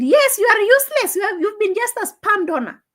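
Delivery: high, scornful impression of the daughter; a daughter jeering at her dying father, high and scornful; mocking daughter voice. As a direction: sneer it out high and cutting. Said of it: "He said".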